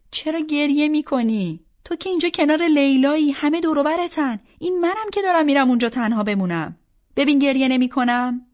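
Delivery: high, loud, pleading protest; emotional protest. Acted. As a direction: plead insistently with a crying friend. Arguing with her crying friend.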